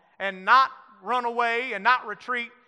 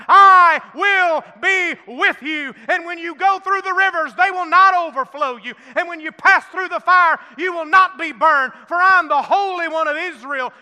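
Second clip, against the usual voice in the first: loud, high, ringing scripture proclamation; full-force preaching; 7 semitones higher, 11 dB louder than usual. Acted. Preacher proclaiming Isaiah to his church in a loud ringing voice. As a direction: thunder the promise over the congregation.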